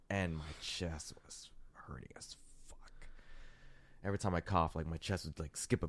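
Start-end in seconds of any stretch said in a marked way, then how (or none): 0.1-3.1 whisper. Real, not acted. Describing pain.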